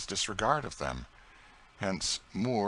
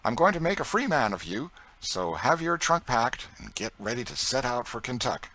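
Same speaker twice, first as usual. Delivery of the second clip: higher, louder master's announcement; Saint Clair announcing; 5 semitones higher, 5 dB louder than usual. Acted. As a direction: deliver the news of freedom boldly. Saint Clair frees Tom.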